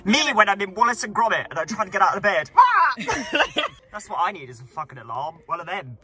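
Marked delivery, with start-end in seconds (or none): 2.6-2.9 sudden high yelp; mock startled yelp. Pretending to leap out of bed like Wallace, a startled yelp. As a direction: yelp like a cartoon waking up.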